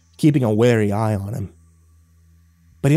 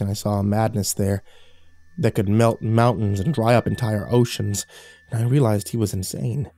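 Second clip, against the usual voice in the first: ordinary delivery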